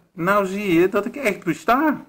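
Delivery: high, loud gloating witch; cackling witch. A narrator's reading. Witch proving to the wolf she exists, high cackle. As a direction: cackle it out in triumph. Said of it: "kakelde ze".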